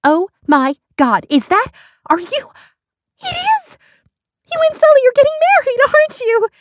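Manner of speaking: high, loud, thrilled exclamation; gasping, excited cry. Acted. Beth spots Lindsay's engagement ring, crying out in excitement. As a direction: gasp it out, building to an excited squeal. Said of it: "she gasped / she cried".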